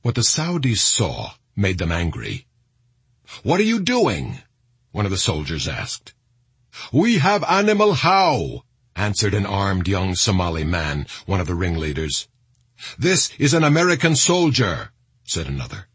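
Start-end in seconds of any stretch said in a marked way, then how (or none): 3.4-4.4 high, indignant demand; angry challenge. A narrator's reading. Saudi soldier to the mob dragging a body, angry. 6.9-8.6 high, forceful retort; defiant answer. A narrator's reading. Armed ringleader answering the Saudi soldiers, defiant. 13.0-14.9 high, insistent declaration; heated protest. A narrator's reading. Another man in the standoff over the body, heated.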